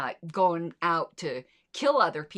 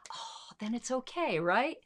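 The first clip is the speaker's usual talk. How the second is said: dry mock agreement, pitched up and soft; sarcastic; 5 semitones higher, 4 dB quieter than usual. Host mocking the proponents' penalty argument, sarcastic. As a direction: pretend to accept it, dripping sarcasm.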